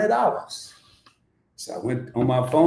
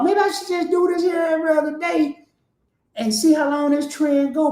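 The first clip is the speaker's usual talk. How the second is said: excited shout; 13 semitones higher than usual. Real, not acted. Excited about gains.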